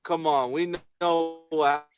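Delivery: usual speaking voice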